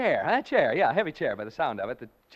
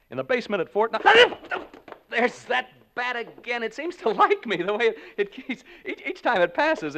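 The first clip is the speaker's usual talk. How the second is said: jittery, higher and louder as the bat swoops; nervous rising voice; 8 semitones higher, 6 dB louder than usual. Acted. Announcer in a haunted house, nervous as a bat circles him. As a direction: flinch through the words.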